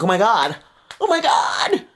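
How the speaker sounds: "oh my God" leaping into a high squeal; scandalized realization, rising very high; mock shock.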